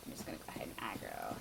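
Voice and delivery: quick, soft whisper. Real, not acted. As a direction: whisper like a sneaky ambush. Whispering before attacking enemy.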